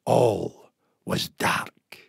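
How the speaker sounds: slow, hushed whisper. A narrator's reading. Narrator tells listeners darkness fell, slow whisper. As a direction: drop to a breath as the lights go out. Sudden darkness falls in tale.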